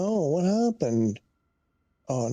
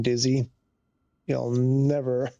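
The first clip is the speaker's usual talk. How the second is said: usual speaking voice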